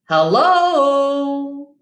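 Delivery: tortoise calling out loud; big greeting shout. Acted. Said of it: "shouted a tortoise".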